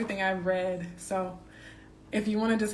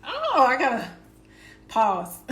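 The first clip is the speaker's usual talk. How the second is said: louder, laughing as she stops; breaking into laughter; 5 semitones higher, 7 dB louder than usual. Real, not acted. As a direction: laugh off the rush of feeling. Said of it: "hard to read that one again without getting emotional".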